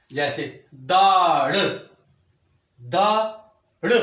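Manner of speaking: दाड़ slow and loud with a heavy ड़; heavy drawn sound. Shown as a demonstration. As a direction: stretch दाड़ out heavily. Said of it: "भारी आवाज़ वाला". (भारी आवाज़ में खींचकर दाड़)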